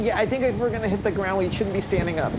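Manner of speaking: high, scared, rushed; panicky. Acted. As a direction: brace for a crash in panic. Panicky bracing for crash.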